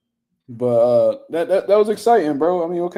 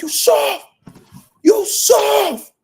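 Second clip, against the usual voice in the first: very high, louder screamed taunt; imitating a screamed taunt; 5 dB louder, much slower than usual. Imitating Gerald Miller screaming at his opponent, high shrieked taunt. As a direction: scream it way up at the top of your lungs. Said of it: "He was screaming to the top of his lungs".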